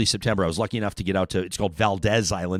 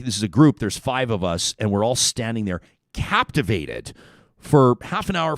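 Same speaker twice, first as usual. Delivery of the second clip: ordinary talk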